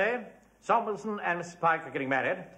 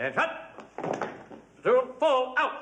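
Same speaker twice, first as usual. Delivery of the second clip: parade ground command; 8 semitones higher, much slower than usual. Acted. Drilling the platoon.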